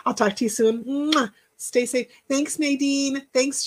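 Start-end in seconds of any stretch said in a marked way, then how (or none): none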